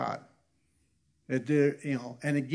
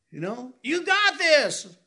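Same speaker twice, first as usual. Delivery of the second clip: mock parent encouragement; 12 semitones higher, 5 dB louder, slower than usual. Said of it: "take that same encouragement and go".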